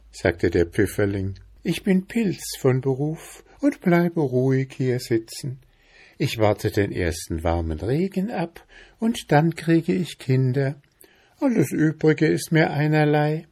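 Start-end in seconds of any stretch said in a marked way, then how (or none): 1.6-13.5 higher little mushroom, untroubled; unruffled squeaky voice. A narrator's reading. Chanterelle brushing off the bear's threat, calm high voice. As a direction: shrug it off in a small high voice. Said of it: "mit einem so piepsigen Stimmchen".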